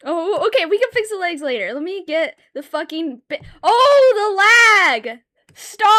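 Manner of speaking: loud, high, frustrated at the lag; real yell at the lagging drawing app. Real, not acted. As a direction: yell at the laggy program. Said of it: "I need to stop yelling".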